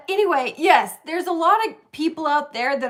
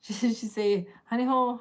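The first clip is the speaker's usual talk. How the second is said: ordinary talk, nothing marked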